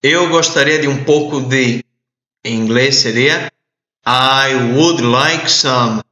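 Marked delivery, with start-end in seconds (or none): 4.0-6.0 very slow, each word separated; slow model. Shown as a demonstration. Teacher modeling the phrase slowly for students. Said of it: "teacher models the phrase".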